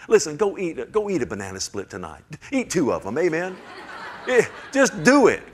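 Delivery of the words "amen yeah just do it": laughing, high and loud. Real, not acted. Preacher urging the ladies to eat banana splits, laughing loudly. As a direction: egg them on through a laugh.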